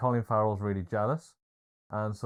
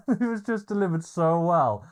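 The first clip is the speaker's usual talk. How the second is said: laughing; 9 semitones higher, 4 dB louder than usual. Real, not acted. Laughing at the film scene.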